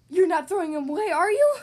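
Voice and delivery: loud, rising dismayed cry. Acted. Brother Bear dismayed at Mama, loud disbelieving cry. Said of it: "cried Brother".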